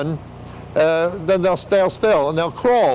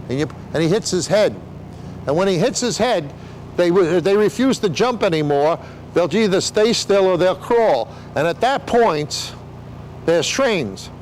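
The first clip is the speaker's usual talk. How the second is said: usual speaking voice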